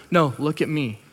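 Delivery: quiet, steady correction; calm firm reply. Acted. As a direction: gently cut through someone's panic. God calmly answering the protest.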